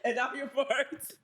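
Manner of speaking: giggling through the words; laughing. Real, not acted. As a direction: laugh while saying it. Laughing at a blessing joke.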